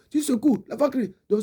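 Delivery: ordinary talk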